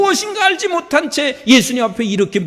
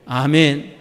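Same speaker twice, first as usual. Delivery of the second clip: usual speaking voice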